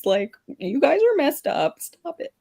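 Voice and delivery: high, worked-up mock scolding; exasperated. Woman berates her disobedient legs, high-pitched exasperation. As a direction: rail at them in a high, exasperated voice.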